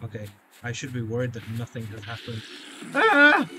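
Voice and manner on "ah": high, loud oh my god; panicked. Real, not acted. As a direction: freak out at the screen. Sudden scare in game.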